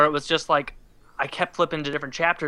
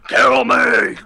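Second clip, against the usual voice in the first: high, loud anguished yell; mock dying scream; 8 semitones higher, 9 dB louder than usual. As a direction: yell it like a hammed-up death plea. Imitates Sam dying, begging for death.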